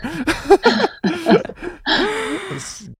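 very high real laugh fading down; real squeal of laughter. Real, not acted. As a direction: shriek with laughter. Laughs after swearing line.

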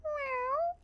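tiny, very high, soft mew; cat's questioning meow. Retold or imitated. Storyteller imitates the cat replying to the parrot, small high meow. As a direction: mew softly with a rising lilt. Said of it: "the cat looks at Sophocles and goes".

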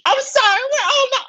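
very high squeaky voice, breaking off; demon voice attempt. Player trying the demon's voice, then giving up. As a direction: try the high screechy demon and lose it. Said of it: "how did I even do the voice again".

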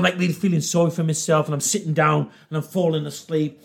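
ordinary delivery

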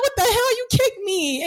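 high, outraged yelp with a crying edge; hammed-up furious nurse; mock angry shout. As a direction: squeal in hurt outrage. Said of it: "Carl was so mad at me".

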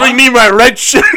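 loud, high laughing order; hammed-up captain's command; mock sea captain. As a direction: call to the crew through laughter. Acted captain ordering crew.

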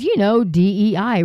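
ordinary talk, nothing marked

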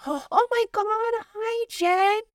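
very high, drawn-out mock squeal of delight; gushing greeting. Meeting a coworker's girlfriend at her desk, gushing. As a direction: greet her in a high, over-sweet gush.

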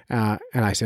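normal talking voice

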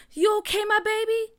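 high, loud anxious question; worried mother. A narrator's reading. Mother checks on hurt son, alarmed and high-pitched. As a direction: rush out concern for your child.

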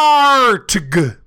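high, loud drawn-out roar; hammed-up monster growl-word; mock ogre roar. A narrator's reading. Misspelt roar read aloud.

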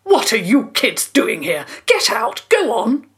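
put-on loud, angry yell at trespassing kids; furious shout. Landowner yelling at kids on his drive, furious shout. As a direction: bark them off the property. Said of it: "staring at them furiously".

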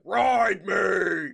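loud mock-British bellow, drawn out; mock lion roar. Podcaster imitates the Narnia lion, mock roaring bellow. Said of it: "the lion that's like".

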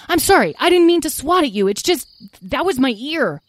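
high-pitched, anxious explaining; flustered apology. Acted. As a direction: apologise in a panic to calm attackers. Tom apologises to angry fairies.

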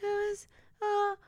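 high, slow, hesitant answer; mock timid answer. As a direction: stammer it out frightened. Terrified victim answers quiz.

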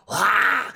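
sudden shouted "ah" noise; imitated odd yelp. Teasing the co-host about their noise, imitated yelp. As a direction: mimic a friend's weird outburst. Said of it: "you did this thing where you were like".

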